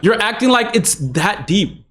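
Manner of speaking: usual speaking voice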